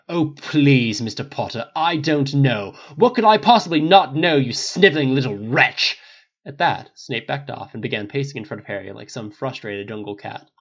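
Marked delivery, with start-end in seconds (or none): 0.1-6.0 sarcastic, building indignation; scornful. A narrator's reading. Snape mocking Harry's protest, scornful. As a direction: throw the insult with contempt.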